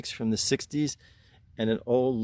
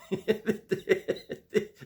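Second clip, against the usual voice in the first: breathy laugh; 8 semitones higher than usual. Real, not acted. Laughs at own joke.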